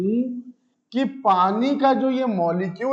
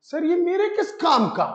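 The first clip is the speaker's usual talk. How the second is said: sceptical student; 8 semitones higher than usual. Pretend. Teacher voices a sceptical student.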